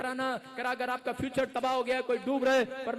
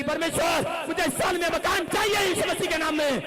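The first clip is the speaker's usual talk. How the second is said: loud, higher shouted prayer; shouting prayer; 5 semitones higher, 7 dB louder than usual. Retold or imitated. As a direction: cry out to God from the rooftop. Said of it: "जोर जोर से चिल्लाने लगी". (चिल्लाकर प्रार्थना)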